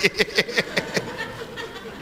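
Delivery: high, soft chuckle put on for Sara; a lightly voiced old woman's giggle; mock old woman's laugh. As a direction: laugh like an old woman who can't believe it.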